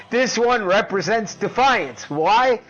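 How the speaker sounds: loud, high showman announcing, slow on so here we have; fight-night hype. Acted. Introducing the yield-fund battle to viewers as a loud ring announcer.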